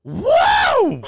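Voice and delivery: high, loud shouted whoa; mimicking the car blasting past the cop; excited whoa. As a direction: yell it as the car rockets past.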